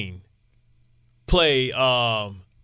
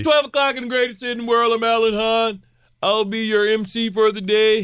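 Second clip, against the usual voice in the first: fast, high radio emcee voice; high-pitched hype-man show opening; hyped show intro; 7 semitones higher than usual. Shown as a demonstration. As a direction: announce yourself as the day's emcee. Demonstrates his hyped show intro.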